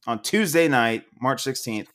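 usual speaking voice

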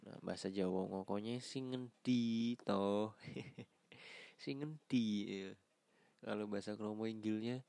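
usual speaking voice

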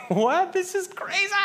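a soldier reeling at what just happened; stunned disbelief. Retold or imitated. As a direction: soldier stunned as the enemy flees. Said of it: "You'd be like".

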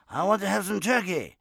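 high, loud Japanese-accented impression; booming, high Japanese accent caricature; mock Japanese accent. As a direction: eagerly answer in a put-on Japanese accent. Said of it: "He was Japanese".

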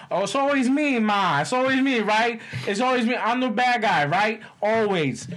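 hammed-up it's-always-me sulk; whiny son. Chef's son whining to his mom, high and loud. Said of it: "He's like".

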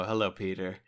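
lightly imitated sultry villain greeting; Alfred Molina. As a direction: give a slight impression of a sultry voice. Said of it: "hearing Alfred Molina's like".

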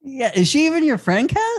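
very high, teasing disbelief; playfully scandalised; mock indignation. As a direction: pile on with high-pitched mock outrage. Piling on the mock grievance.

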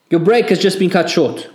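high, loud barked order; angry shout. A narrator's reading. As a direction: shout it down the line. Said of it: "Borden shouted".

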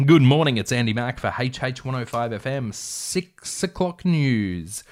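morning radio news intro; radio news opener. Acted. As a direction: announce the bulletin, drawing out the final words. Reading out a radio news opening.

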